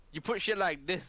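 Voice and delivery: laughing, exasperated protest; mock exasperation. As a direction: argue back in playful disbelief. Arguing back at the game's creator.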